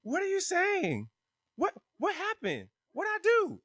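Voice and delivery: high, slow bewildered voice; baffled imitation. Whitley asks what she did, high baffled imitation.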